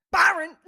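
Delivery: "Byron" punched out short and hard, like a jab. Retold or imitated. Imitating Dale Junior waking you from a nap, punched Byron. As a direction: throw "Byron" like a punch in the throat. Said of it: "It's just a punch in the throat".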